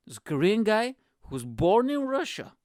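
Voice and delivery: normal talking voice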